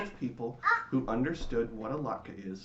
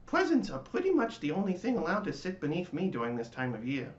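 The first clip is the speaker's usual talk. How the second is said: louder, higher pine tree voice; 7 semitones higher, 4 dB louder than usual. A narrator's reading. The pine tree lectures the latke, higher voice. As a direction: explain it like a smug old tree.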